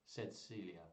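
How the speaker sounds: plain reading voice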